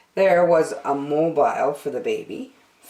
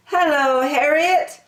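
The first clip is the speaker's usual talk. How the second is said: tender greeting; 11 semitones higher, 3 dB louder than usual. Acted. Franklin tender.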